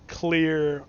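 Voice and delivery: ordinary talk, nothing marked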